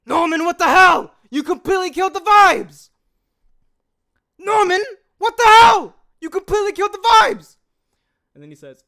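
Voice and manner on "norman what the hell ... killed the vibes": loud, exasperated shout at a friend; outraged yell. Acted. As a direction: yell it out, indignant. Outraged character in voice acting takes.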